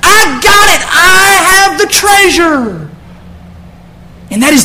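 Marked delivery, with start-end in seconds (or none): 0.0-2.9 joyful whoop. Retold or imitated. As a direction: whoop like a man who just struck treasure. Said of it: "No, he's like".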